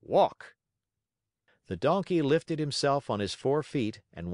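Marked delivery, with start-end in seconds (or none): 0.0-0.6 loud, raised one-word order; crisp circus command; sharp command. A narrator's reading. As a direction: cry it out sharply like a ringmaster. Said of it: "cried sharply".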